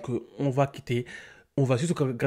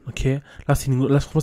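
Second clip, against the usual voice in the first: ordinary talk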